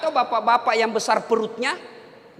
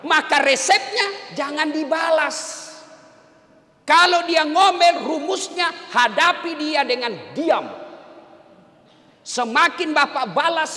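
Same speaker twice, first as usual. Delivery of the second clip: loud, higher sermon delivery; preaching at full force; 10 semitones higher, 5 dB louder than usual. Acted. Ustaz preaching to husbands about nagging wives, full force. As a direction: preach it out to the whole hall.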